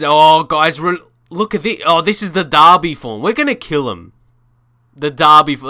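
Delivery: bursts of loud, excited exclamation; real excitement. Real, not acted. Gamer showing viewers the derby form, excited. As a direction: blurt it out to the viewers, thrilled. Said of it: "I'm really excited".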